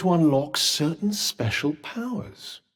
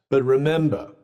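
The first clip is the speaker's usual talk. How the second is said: normal talking voice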